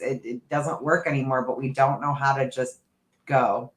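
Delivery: ordinary talk, nothing marked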